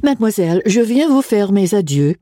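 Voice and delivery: a lightly voiced schoolgirl in polished French; cool, unconcerned French farewell, perfect accent. Becky bidding her headmistress farewell, in perfect French. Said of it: "said in French, with a perfect accent".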